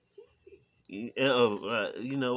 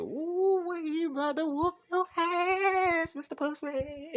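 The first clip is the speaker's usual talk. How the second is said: squeaky high, slow hyped-up voice; hammed-up high-pitched taunt; high mock voice; slower than usual. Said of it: "and you go".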